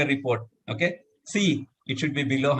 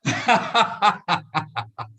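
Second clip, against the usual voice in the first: bursting laugh on the exhale; exhaled laugh; 7 semitones higher than usual. Shown as a demonstration. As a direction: let the held breath out as laughter. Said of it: "Laugh it off".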